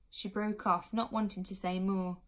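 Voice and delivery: ordinary delivery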